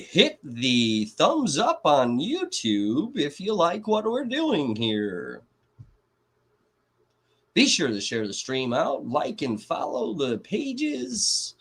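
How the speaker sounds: high, slow and over the top announcer; fake reporter voice. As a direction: play a cheesy TV host signing off. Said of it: "do the fake reporter voice".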